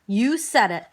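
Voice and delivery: ordinary delivery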